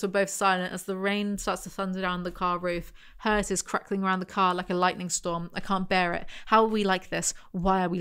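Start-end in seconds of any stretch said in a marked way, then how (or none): none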